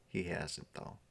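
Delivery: usual speaking voice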